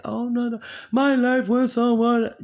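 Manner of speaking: high, loud imitation of a moaning neighbor; drawn-out, high-pitched mock lament; mock complaining. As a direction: mimic her woe-is-me griping. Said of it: "She complained too goddamn much".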